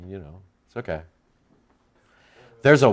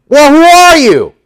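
high, loud, insistent demand from the Pharisees; demanding questioners; 10 dB louder than usual. Retold or imitated. Pharisees grilling John, high and loud. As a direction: push him hard for a straight answer.

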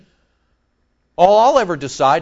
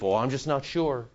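much softer, lower doubtful aside; mock doubt; 5 semitones lower, 9 dB quieter than usual. Preacher voices a doubting believer, soft mock doubt. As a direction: voice a hesitant believer, quietly.

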